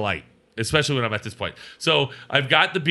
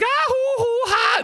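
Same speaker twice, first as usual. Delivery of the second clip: very high whooping cackle; imitated girl's squeal; slower than usual. Imitating the girl with Al, high giddy squealing. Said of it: "this girl's like".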